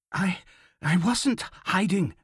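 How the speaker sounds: halting, high-pitched protest; insistent denial. A narrator's reading. Young man answering the officer, insisting nervously. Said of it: "the young man insisted".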